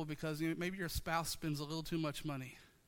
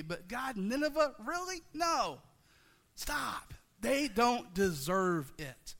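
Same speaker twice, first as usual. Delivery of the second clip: indignant Jonah; 4 semitones higher, much slower than usual. Retold or imitated. Jonah protesting God's mercy, mock indignant whine. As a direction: pitch leaps high on really, then slow. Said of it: "Jonah's saying".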